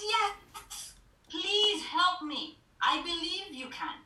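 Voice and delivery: high, loud acted plea; desperate pleading. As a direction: beg urgently to be rescued. Falling man pleads with the voice.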